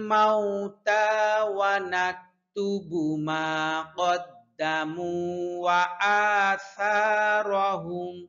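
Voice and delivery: slow tajweed chant; Quran recitation. Shown as a demonstration. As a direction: recite the verse slowly and evenly. Recites verse again slowly. (ধীর সুরেলা তিলাওয়াত)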